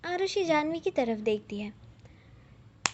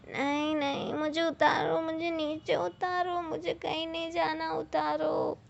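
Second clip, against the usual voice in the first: put-on whiny drunk protest; 6 semitones higher than usual. Drunk Arushi carried, protesting.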